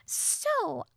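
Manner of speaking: very high, tentative fairy voice; Tattle. A narrator's reading. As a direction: ask haltingly in a tiny squeaky pitch. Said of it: "Tattle said".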